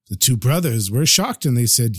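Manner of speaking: plain reading voice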